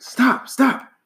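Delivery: high pleading cry; pleading yell. A narrator's reading. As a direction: beg it to stop, high and urgent. Rock hitting Rabbit's head.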